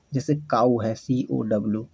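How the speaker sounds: usual speaking voice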